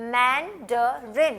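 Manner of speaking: Mandarin said very slowly with breaks between syllables; broken into syllables. Shown as a demonstration. Teacher showing students how to break Mandarin into parts. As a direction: pause between each part of Mandarin. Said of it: "break कर लिया करें".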